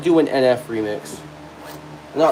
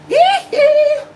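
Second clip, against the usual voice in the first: high, loud falsetto "hee-hee"; piercing falsetto ad-lib; 5 dB louder than usual. Acted. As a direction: squeal a two-note pop-star yelp.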